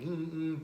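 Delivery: high closed-mouth whimper; imitated dog whine. Trainer imitating a crated dog that starts whining. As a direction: mimic a whining puppy. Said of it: "they start whining".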